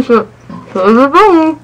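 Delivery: muffled, cheeks packed; mouth full. Real, not acted. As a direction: squeeze words past food. Mouth stuffed with marshmallows.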